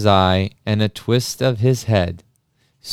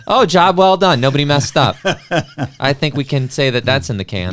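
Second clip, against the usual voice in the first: bright, laughing relief; delighted; 5 semitones higher, 3 dB louder than usual. Real, not acted. Host cheering the cast after the reading, laughing. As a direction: cheer it like the take went perfectly.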